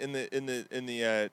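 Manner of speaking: ordinary talk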